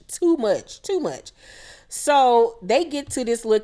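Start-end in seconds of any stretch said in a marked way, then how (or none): 0.1-0.8 mocking tsk of too much; sassy repeat. Real, not acted. Scolding the housewives, with sassy attitude. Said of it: "y'all be doing too much". 0.8-1.3 Too much said higher and softer as an echo. Real, not acted. Echoing her own scolding of the cast, high and soft. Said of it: "y'all be doing too much".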